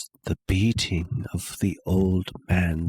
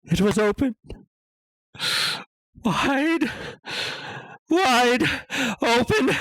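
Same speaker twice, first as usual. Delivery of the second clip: high, tense cry of horror at the open eye; rising horror; 13 semitones higher, faster than usual. Acted. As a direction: pitch it up as the dread peaks. Horrified sight of the vulture eye.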